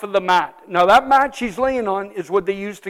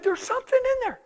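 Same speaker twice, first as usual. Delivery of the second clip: much higher hushed warning; whisper-warn of the presence; a touch of villager dread; frightened villagers; 8 dB quieter than usual. Retold or imitated. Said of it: "And they said".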